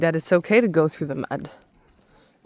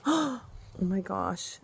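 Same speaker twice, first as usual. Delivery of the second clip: startled gasp; 3 semitones higher, 9 dB quieter, slower than usual. Real, not acted. Nearly steps on a slug.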